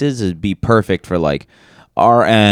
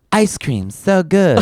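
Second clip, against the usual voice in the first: put-on hologram girl voice; 8 semitones higher, slower than usual. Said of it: "They're gonna be like".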